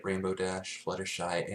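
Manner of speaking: ordinary delivery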